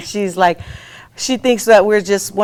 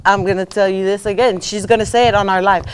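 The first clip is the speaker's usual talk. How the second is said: usual speaking voice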